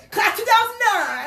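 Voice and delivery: high, loud imitation of dad hollering; yelled out the window. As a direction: shout it proudly for the whole street. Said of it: "he yelled out the window".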